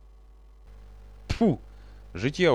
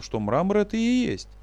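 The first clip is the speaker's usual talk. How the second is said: usual speaking voice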